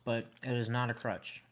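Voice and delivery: ordinary delivery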